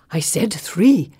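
slow, winded complaint; gasping, winded. A narrator's reading. Companion after a hard fall, gasping. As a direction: speak as if the lungs are broken. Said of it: "gasps as if his lungs are broken".

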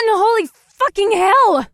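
high, loud alarmed shout; shouted at full volume, pitched high; shout over the gale. A narrator's reading. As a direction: yell it over a roaring wind. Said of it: "Penelope shouted over the gale".

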